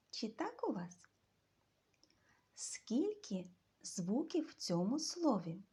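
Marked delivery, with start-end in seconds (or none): none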